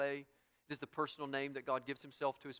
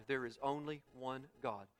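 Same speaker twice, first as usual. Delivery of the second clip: usual speaking voice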